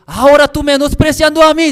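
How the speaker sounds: high, loud accusation back; heated retort. Retold or imitated. Seminarian retorting to the pastor, loud.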